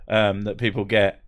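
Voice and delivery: ordinary delivery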